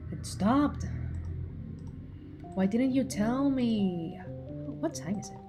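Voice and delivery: ordinary talk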